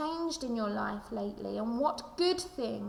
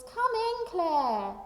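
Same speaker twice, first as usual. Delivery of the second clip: lightly voiced grandma calling out high; a hint of a grandma calling from the door; 6 semitones higher, 4 dB louder, much slower than usual. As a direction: call the child in like a grandma. Grandma calls child in from outside.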